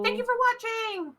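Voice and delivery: high bright thank-you shout; cheery sign-off. Real, not acted. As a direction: call it out happily to viewers.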